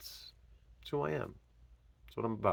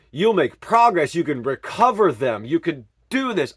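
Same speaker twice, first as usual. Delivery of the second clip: raised, urgent voice pleading with the officers; louder and higher, begging them to pass it on; impassioned plea; 5 semitones higher, 6 dB louder, slower than usual. Retold or imitated. Man pleading loudly with arresting officers to remember him. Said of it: "I remember saying".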